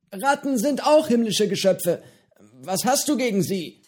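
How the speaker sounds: höhere, lockere Piratenstimme, freundlich plaudernd; Johnny, der alte Pirat, prahlt gemütlich mit seinem Fisch; gutmütiger Pirat Johnny. A narrator's reading. Johnny verteidigt die Ratten. (höhere Stimme eines gutmütigen alten Piraten)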